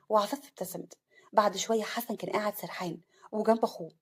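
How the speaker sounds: ordinary delivery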